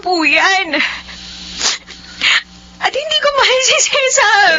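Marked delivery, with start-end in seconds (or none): 2.8-4.5 crying, pitch up; tearful cry of refusal. Acted. Niece refuses arranged groom.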